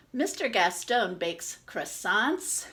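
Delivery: usual speaking voice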